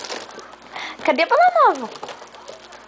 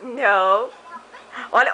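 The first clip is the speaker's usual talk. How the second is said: normal talking voice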